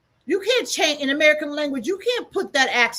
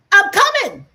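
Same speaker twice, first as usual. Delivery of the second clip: the quote said much higher and louder; pitch demo; 9 semitones higher, 9 dB louder than usual. Shown as a demonstration. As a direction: throw the line up high to show the tone. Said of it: "It means the pitch".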